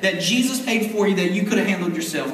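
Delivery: ordinary delivery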